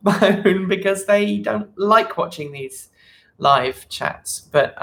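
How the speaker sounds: normal talking voice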